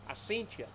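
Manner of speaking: "seen" said with a nasal vowel, close to "scent" with an E; "I seen you", "seen" sounding like "scent"; Appalachian "seen". Shown as a demonstration. Teaching the partner how to say "seen", Appalachian pronunciation. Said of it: "It's seen".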